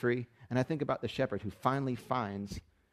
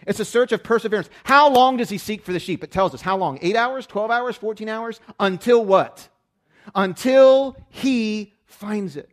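loud, high, driving sermon delivery; full-throated pulpit preaching; preaching at full force; 8 semitones higher, 11 dB louder than usual. Acted. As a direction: preach it hard to the room.

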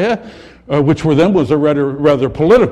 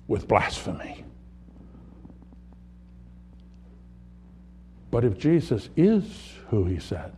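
hushed solemnity; 5 semitones lower, 8 dB quieter than usual. Acted. Sermon softens to a hush.

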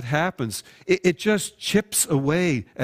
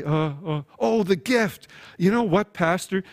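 caught off guard; 4 semitones higher than usual. Acted. Caught not opening the gift.

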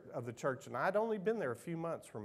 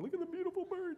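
much higher, gushing mock-tearful mother voice; imitating weepy mother; faster than usual. Pastor imitating his mother gushing over a bird. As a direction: gush over the bird like mom does. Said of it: "see a bird fly through the air and just start bawling".

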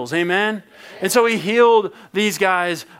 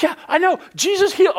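high, excited outburst; excited blurt; 11 semitones higher, faster than usual. Acted. Healed man telling a neighbour, high and excited.

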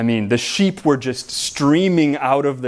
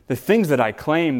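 normal talking voice

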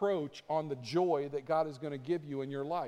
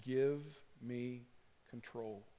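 soft, hushed voice of God; gentle urging that sinks almost to a whisper; quiet near-whisper; 2 semitones lower, 5 dB quieter, much slower than usual. Acted. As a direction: say it very quietly, with a pause midway. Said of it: "very quietly whispering to us".

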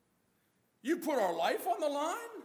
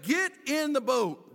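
slow, louder urgent order; frightened command; 3 semitones higher, 7 dB louder, slower than usual. Retold or imitated. Disciples urging Jesus back into the boat, loud and slow. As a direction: bark it out like scared sailors.

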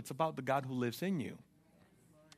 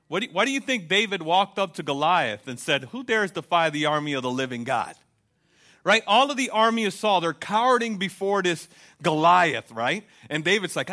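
loud, driving sermon delivery; preaching at full force; 2 semitones higher, 7 dB louder than usual. Acted. Preacher rousing the church, loud and driving. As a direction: preach it out to the congregation.

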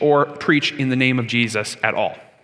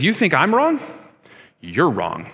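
bold retort; slower than usual. Retold or imitated. Preacher voicing Peter answering his critics, blunt retort. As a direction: flip the accusation right back. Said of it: "He says".

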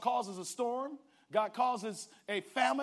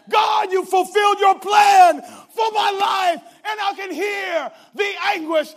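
Joseph's anguished cry; 9 semitones higher, 15 dB louder than usual. Acted. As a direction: cry out to God like Joseph before his brothers. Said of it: "he's saying".

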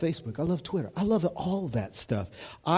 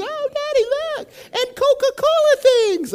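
put-on much higher, squeaky child voice begging daddy; excited little girl; 7 dB louder than usual. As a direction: play the eager little daughter tugging at dad. Daughters begging in gift shop.

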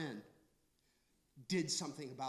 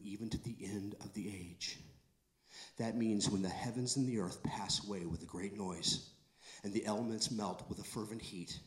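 hushed preaching; 8 semitones lower, 6 dB quieter than usual. Acted.

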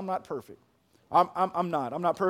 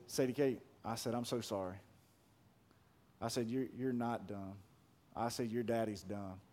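tender apology; 6 semitones lower, 11 dB quieter than usual. Retold or imitated.

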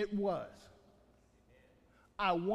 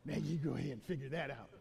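much lower, softer, fast scoffing brush-off; smart aleck; 8 semitones lower, 6 dB quieter, faster than usual. Retold or imitated. Preacher mimics a smart aleck dismissing creation, low quick scoff. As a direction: toss it off like a know-it-all daring you. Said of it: "that's what the smart aleck will sit there and say".

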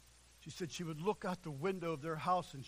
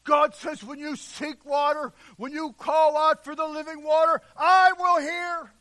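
loud, high, rolling preacher's cry; fiery sermon delivery, voice ringing; full-force preaching; 10 semitones higher, 18 dB louder than usual. Acted. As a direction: thunder it out from the pulpit.

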